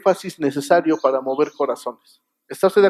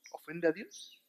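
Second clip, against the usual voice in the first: "¿Ofende a Dios?" asked almost under the breath, much softer; hushed question; 20 dB quieter, slower than usual. Shown as a demonstration. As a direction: drop to a hushed self-question. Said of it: "Y vas a decir". (pregunta "¿Ofende a Dios?" casi susurrada, mucho más suave)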